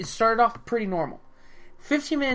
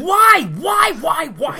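high, shouted exasperation; real exasperated outburst; loud protest; 8 semitones higher, 11 dB louder, much slower than usual. Real, not acted. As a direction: fire it out repeatedly, pitched way up. Protesting friend's teasing remark.

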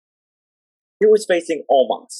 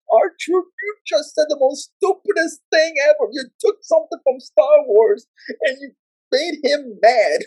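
high scolding yell; high exasperated scolding; 12 semitones higher than usual. Retold or imitated. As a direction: scold him from ringside. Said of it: "because I was like".

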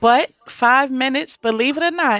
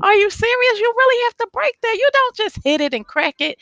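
higher, fast, incredulous scolding; mock exasperation; 12 semitones higher than usual. Owner scolding customers who barely break things, mock exasperated. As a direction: scold them in playful disbelief, pitch up. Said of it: "It makes me wanna rage because I'm like".

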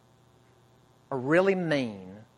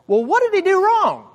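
put-on high, loud, pleading child's voice; upset camper's cry; 7 dB louder than usual. Upset camper asks the leader, in a high, loud, pleading voice. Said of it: "one of the campers became very upset".

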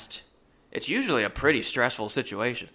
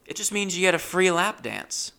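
higher, lightly voiced dancer; mock stripper pitch, higher; quoting the dancer; 8 semitones higher than usual. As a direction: sell it sweetly like the dancer. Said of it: "and I quote".

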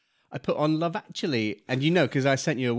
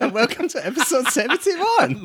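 high, loud sing-song Welsh intro; playful fake Welsh welcome; mock Welsh; 10 semitones higher, 6 dB louder than usual. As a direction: ham up a Welsh announcer. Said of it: "That was Welsh".

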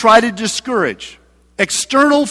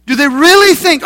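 louder scornful jeer, REALLY leaned on; louder mocking; 5 semitones higher, 5 dB louder than usual. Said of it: "he's saying in a mocking voice".

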